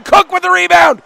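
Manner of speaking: high, loud shout of the rebound chance; thrilled call with a laugh; excited shout. Real, not acted. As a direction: yell it as the save happens, laughing. Calling a rebound and big save.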